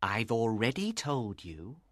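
low, slow and unruffled; calm reply. A narrator's reading. Father reassures his anxious daughter, calmly. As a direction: reassure calmly in a deep, unhurried voice. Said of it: "her father replied calmly".

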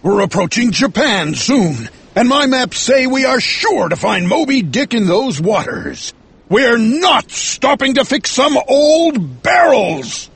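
high-pitched, mounting fury; angry captain. A narrator's reading. Captain refuses to stop for old barrels, angry.

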